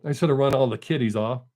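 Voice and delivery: ordinary delivery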